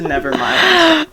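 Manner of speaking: usual speaking voice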